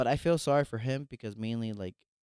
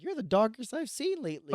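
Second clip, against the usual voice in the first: put-on high old lady voice. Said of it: "She's like".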